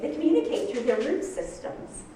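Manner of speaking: ordinary talk, nothing marked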